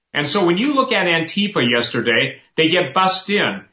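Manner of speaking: ordinary talk, nothing marked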